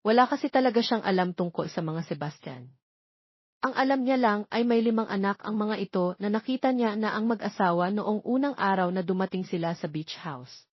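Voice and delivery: ordinary delivery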